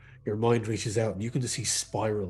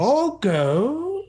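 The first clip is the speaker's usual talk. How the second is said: sharp, high, loud crone; fortune teller; 8 dB louder than usual. Acted. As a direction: snap it out as a mystic. Fortune teller dismisses him.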